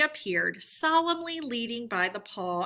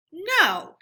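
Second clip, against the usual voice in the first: much louder, higher flat no; firm refusal; 9 semitones higher, 9 dB louder than usual. Acted. Toad refusing to repent before friends, firmly. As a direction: plant your feet and refuse. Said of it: "he said quite firmly".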